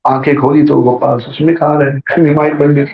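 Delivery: normal talking voice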